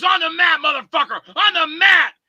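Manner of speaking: high, loud imitated challenge; mock challenge shout. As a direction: bark the dare like a furious tough guy. Guy squaring off, retold.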